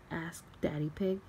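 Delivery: ordinary delivery, nothing marked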